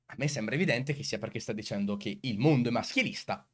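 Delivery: normal talking voice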